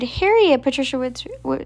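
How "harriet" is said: ordinary delivery, nothing marked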